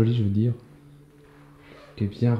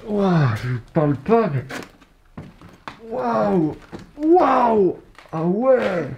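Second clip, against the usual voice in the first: gasped words while holding breath; 9 semitones higher, 4 dB louder, much slower than usual. Real, not acted. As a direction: squeeze it out without breathing. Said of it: "je retiens ma respiration".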